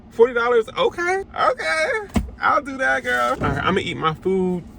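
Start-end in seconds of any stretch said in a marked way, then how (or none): 0.1-3.3 high, squeaky mock agreement; mock disbelief. Mocking the parking price.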